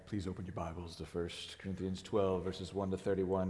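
smooth it out like a late-night radio host. Shown as a demonstration. Pastor battling voice trouble jokes to congregation, in late-night radio voice. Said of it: "late night radio voice".

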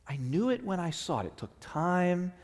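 ordinary talk

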